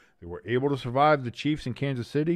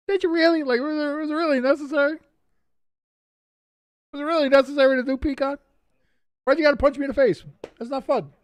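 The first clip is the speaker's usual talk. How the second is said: much higher, whiny crybaby voice; whiny toddler; 13 semitones higher, 4 dB louder, faster than usual. Retold or imitated. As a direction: whine like a toddler who just got punched. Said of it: "Yes, I'm a toddler".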